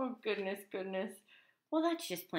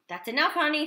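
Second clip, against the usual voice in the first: firm mother; 7 semitones higher, 6 dB louder, faster than usual. Acted. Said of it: "said Crispin's mother".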